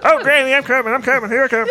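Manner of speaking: very high, loud, laughing squeal; shrill squeaky voice. Acted. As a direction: squeal it out while cracking up. Character rushes to grandmother.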